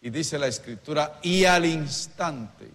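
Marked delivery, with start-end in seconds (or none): 1.2-2.7 y al instante stretched slowly and loudly, words set apart; slow, loud preacher's emphasis on y al instante; slow emphatic repeat. Shown as a demonstration. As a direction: drag out y al instante so the church can echo it. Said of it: "diga conmigo".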